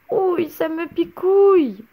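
put-on sweating boy begging; pleading ouch. A narrator's reading. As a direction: beg while it stings. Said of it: "supplia-t-il en transpirant".

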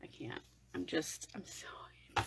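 breathless whisper; too giddy to speak aloud; overwhelmed whisper. Real, not acted. Overwhelmed by the new palette, breathless whisper.

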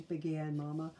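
ordinary delivery

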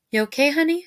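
half-awake mom, lightly groggy. A narrator's reading. Mom woken at night asking her son, mildly groggy. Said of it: "she said groggily".

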